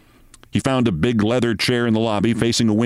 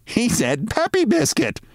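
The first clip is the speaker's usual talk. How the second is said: much higher, slower mocking woman's voice; woman mocking to her friend; amused passer-by; 13 semitones higher than usual. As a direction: repeat it with a scornful giggle. Woman mocks Mitty to companion.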